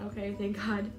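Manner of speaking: usual speaking voice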